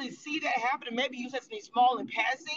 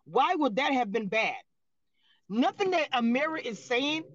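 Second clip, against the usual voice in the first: ordinary delivery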